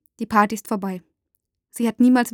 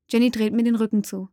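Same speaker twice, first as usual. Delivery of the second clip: ordinary delivery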